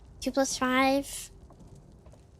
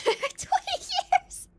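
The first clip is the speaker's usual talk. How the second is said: laughing; much slower than usual. Real, not acted. Laughing squeal.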